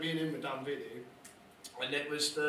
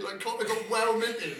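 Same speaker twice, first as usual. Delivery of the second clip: ordinary talk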